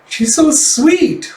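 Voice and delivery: high, slow, loud gushing; charmed cooing. Acted. Cafe owner taken with the polite newcomer, cooing. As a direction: draw out a delighted coo.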